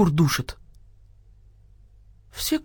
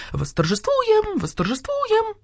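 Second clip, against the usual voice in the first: high, slow clucking chorus; echoing hens; 13 semitones higher, slower than usual. A narrator's reading. Hens take up the rooster's words, high chorus. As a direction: echo it high, drawn out like hens. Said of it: "подхватили куры".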